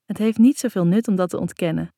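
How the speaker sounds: ordinary delivery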